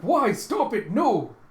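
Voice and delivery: high, very loud pleading cries; shocked yelling. Acted. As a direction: shout desperately to stop her. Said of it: "Byron's shocked".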